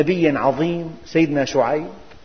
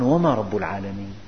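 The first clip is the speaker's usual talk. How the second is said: Pharaoh's scorn; 8 semitones lower, 6 dB quieter than usual. Acted. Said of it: "قال فرعون".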